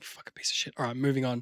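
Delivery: whispered, irritated brush-off; muttered aside. Retold or imitated. The other person reacting to an excuse, muttered irritation. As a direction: curse under your breath and move along.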